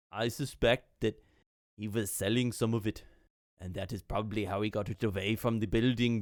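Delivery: put-on accented official thinking it through; Atulf. Atulf tells the party his suspicions, accented and pondering. Voices Atulf musing on smuggling.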